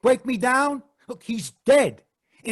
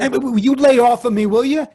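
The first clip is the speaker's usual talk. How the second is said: loud stammering protest; defensive outburst; 7 dB louder than usual. Acted. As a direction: push them off, rattled. Accused man lashes out.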